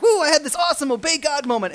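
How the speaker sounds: high, loud whoop of triumph; mock elation. Believer celebrates an obey God moment, mock elation. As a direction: crow it in giddy celebration. Voices elation after obeying.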